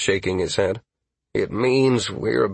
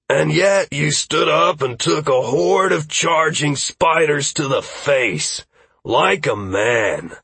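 slow, higher proud praise; 6 semitones higher, slower than usual. Acted. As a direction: say it slowly, full of pride. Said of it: "Trey said proudly".

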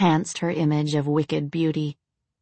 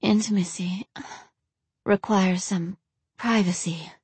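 breathy gasping whisper; 3 semitones higher, 3 dB quieter than usual. A narrator's reading. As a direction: whisper broken by soft gasps. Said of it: "Gasps and soft whimpers punctuated her words".

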